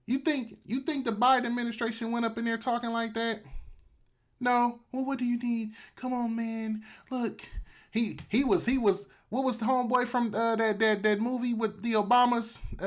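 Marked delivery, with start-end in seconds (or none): 4.9-7.4 meek official voice. Acted. Voices Biden officials meekly asking.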